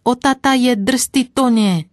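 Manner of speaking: put-on high, loud squealing little girl; screeching child. Little girl shrieking at the horses from the cart. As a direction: shriek it like a scared five-year-old. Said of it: "už začala ječet".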